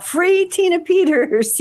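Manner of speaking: high, slow, chanted yell; girl yelling her support across the yard, slow and high; yelled slogan. Retold or imitated. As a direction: shout it like a rallying cry. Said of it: "she'd yell out".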